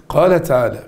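usual speaking voice

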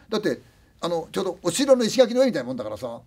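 ordinary talk, nothing marked

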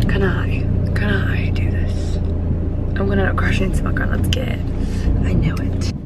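hushed whisper so granddad won't hear; creeping up on granddad's gate, whispering. Real, not acted. Vlogger sneaking up to granddad's gate, whispering.

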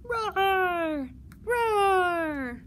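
two high, loud, drawn-out roars; dinosaur roaring. Acted. Librarian showing how the book's dinosaur goes, roaring. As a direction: roar big like a picture-book dinosaur. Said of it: "He's roaring".

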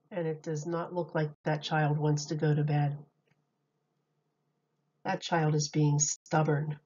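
ordinary delivery, nothing marked

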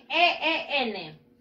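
letters one at a time, evenly spaced; separate, measured letter names; spelled out. Shown as a demonstration. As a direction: spell it slowly for learners to follow. Teacher spells the ending for learners.

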